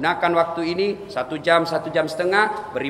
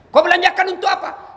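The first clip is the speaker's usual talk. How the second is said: high, loud demanding question; loud, pitched-up questioning; stern interrogation; 14 semitones higher, 5 dB louder than usual. Acted.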